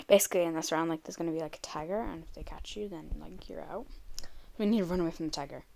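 ordinary talk, nothing marked

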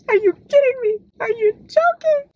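very high squealed disbelief; overwhelmed shriek. Real, not acted. Overcome by book's romantic line.

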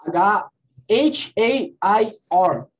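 letters H A I R spelled out one by one, slowly; teacher spelling slowly so students can write. Shown as a demonstration. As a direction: spell each letter deliberately for the class. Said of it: "porque não é hare assim".

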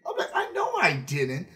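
ordinary delivery

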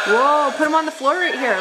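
high playful gasp; thrilled for the child; excited gasp. Pretend. Excited play with toy.

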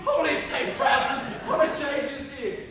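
loud, high burst of shock; astonished exclamation. Acted. Friar hears Romeo has dropped Rosaline, astonished. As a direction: exclaim in amazement, pitched well up.

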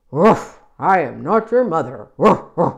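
loud, low barking storybook dog; woofing dog, low and forceful. Acted. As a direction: bark the words like a big dog. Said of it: "said the dog".

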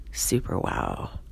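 low, slow, hushed wonder; soft, drawn-out low repeat; awestruck murmur. Acted. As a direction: let it sink away in awe.

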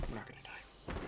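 whispered reassurance to self; soft unvoiced whisper. Real, not acted. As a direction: breathe it to calm yourself.

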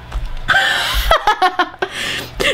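long high-pitched giggling; high hammed-up laughter; giddy acted laugh. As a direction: giggle with overplayed delight at the invite. Acted bro reacting to ice-cream invite.

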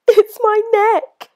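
high, slow sniffly giraffe voice; a forlorn little giraffe; sad giraffe. A narrator's reading. Giraffe complaining to George, high and sad. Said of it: "sniffing sadly".

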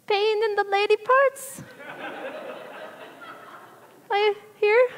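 high, slow, timid squeak; tiny squeak. Retold or imitated. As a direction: squeak it out like you want to vanish. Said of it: "I didn't say it like that, but that's how I felt".

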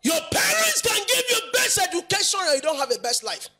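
sudden high preacher's outburst; impassioned preaching. Acted. Preacher warning that schooling does not secure life, impassioned. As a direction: launch in high, then come down.

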